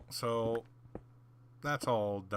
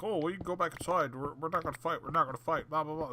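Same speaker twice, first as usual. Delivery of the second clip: higher mock voice, quick; 8 semitones higher, 5 dB louder, faster than usual. Imitating the guys calling off the fight, higher mocking voice. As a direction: mimic them brushing it off.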